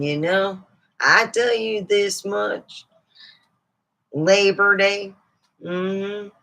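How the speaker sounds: a hint of a chatty old lady telling family stories; mildly put-on folksy lady; snake lady voice. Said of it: "Let's bring out Miss Snakers herself".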